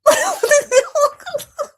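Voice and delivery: loud, high-pitched helpless laugh; reenacted laughing fit. Showing how the lady's twang broke her up, loud high laughing. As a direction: crack up loudly, high and breaking. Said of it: "I was just like".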